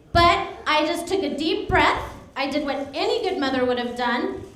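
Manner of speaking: ordinary talk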